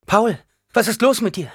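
high, loud alarm; frightened, urgent questioning; horrified. A narrator's reading. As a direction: cry out to your son in horror. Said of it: "Ihre Augen blickten entsetzt".

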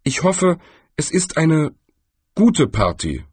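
mildly tentative, courteous small talk; polite. A narrator's reading. Galder avoids making enemies of Death, polite. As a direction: offer it with careful politeness. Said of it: "fügte er höflich hinzu".

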